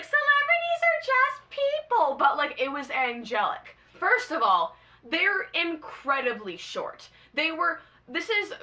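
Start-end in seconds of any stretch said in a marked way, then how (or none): 0.0-2.2 high, mock-blasé singsong; mocking jaded voice. Mimicking her own jaded take, high and sneering. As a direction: play the too-cool fan who shrugs it off. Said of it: "I say as somebody who's like".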